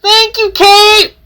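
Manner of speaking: high-pitched call from afar; calling from a distance. Acted. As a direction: call it out high to someone far away. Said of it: "called Conroy from in the distance".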